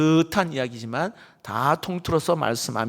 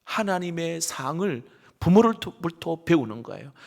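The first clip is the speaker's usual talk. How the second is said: ordinary talk, nothing marked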